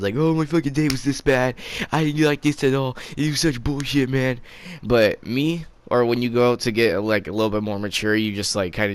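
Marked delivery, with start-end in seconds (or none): 0.2-4.4 high-pitched put-on griping; mock gossiping kid. Immature kid gripes to friends about the day, mock whiny. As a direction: complain in a higher immature voice. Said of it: "you go gossiping to all your friends".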